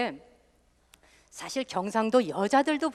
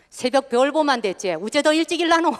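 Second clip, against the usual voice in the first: indignant Gyeongsang dialect, rising higher at the end; Gyeongsang wife's retort; 5 semitones higher, 5 dB louder than usual. Retold or imitated. Host imitates a Gyeongsang wife refusing to rise earlier. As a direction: protest like a put-upon Gyeongsang wife. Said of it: "이러니까".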